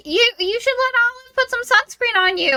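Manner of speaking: very high, put-on voice; suggestive prompting. An volunteering Olive to apply sunscreen, high put-on prompting. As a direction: pitch it way up, imitating a meddling friend.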